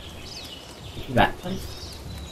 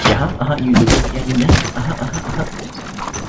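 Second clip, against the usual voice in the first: taunting; 6 semitones higher than usual. Acted. Taunting the training dummy.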